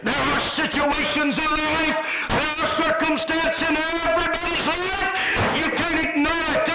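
driving, sustained pulpit cry; full-force preaching. Acted. Preacher warning the congregation life must be met, full-force preaching. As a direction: press each phrase out with fervor.

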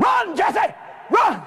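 high-pitched urgent cry; mock scream. Brother warning Jesse to flee, screaming. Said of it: "[name]'s screaming".

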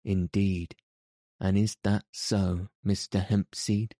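ordinary delivery

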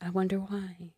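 whispered sarcastic aside; dry sarcastic whisper. Real, not acted. Sarcastic aside on bad sex.

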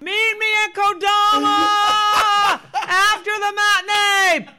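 high, loud shout from the stage; yelling. Retold or imitated. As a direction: yell it over the noise. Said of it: "used to yell out things".

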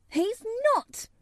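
put-on very high, near-shouted girl's cry; fierce indignation. A narrator's reading. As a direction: leap in indignantly, pitched right up. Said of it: "almost shouting ... in her fierce indignation".

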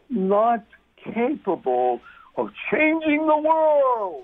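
slow build to a high, drawn-out cry; theatrical, soaring exclamation; dramatic build. Acted. Voicing self-doubters, builds to cry.

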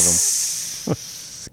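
unvoiced hiss through teeth; a long, rising tire-leak hiss; leaking air hiss. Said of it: "Are you leaking air over there?".

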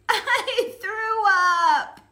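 put-on high, very slow little girl voice; a small sad child confessing; toddler voice. Toddler announcing she vomited.

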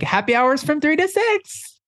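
high, drawn-out movie-mom impression; Mean Girls impression. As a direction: imitate the Mean Girls line, high-pitched and slow. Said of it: "remind you of Mean Girls".

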